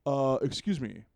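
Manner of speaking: loud, wooden hero line; stiff game hero voice. Retold or imitated. Gamer acts the hero's stiff greeting, loud and wooden. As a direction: deliver it like stilted game dialogue. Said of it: "That's a real line".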